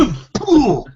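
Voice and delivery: high, falling burst of breath; imitated impact grunt. Game master acts out the hard landing on your back, winded grunt. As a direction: air knocked out in one mock whump.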